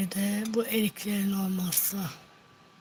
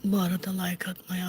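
normal talking voice

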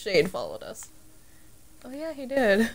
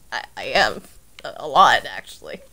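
the voice; 12 semitones lower than usual. Pretend. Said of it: "can you stop doing the voice?".

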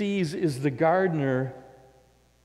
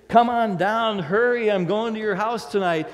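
high, loud call up into the tree; 8 semitones higher, 8 dB louder than usual. Retold or imitated. Jesus calling Zacchaeus down from the tree, loud and high. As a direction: shout it up to him, eager.

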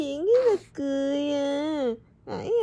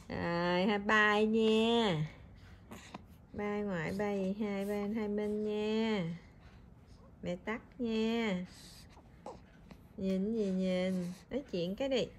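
low, much softer winding-down voice; soft goodbye; 5 semitones lower, 10 dB quieter than usual. Real, not acted. Mother saying bye to grandma and family for baby, soft low farewell.

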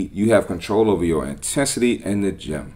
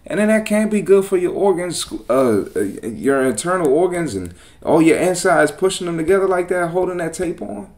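exasperated rant; 6 semitones higher than usual. Real, not acted. Ranting at Shade Room.

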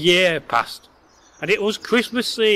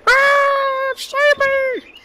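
very high squeaky troll cry; screaming troll; much slower than usual. A narrator's reading. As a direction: squeal for help like a fleeing troll. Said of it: "screaming away".

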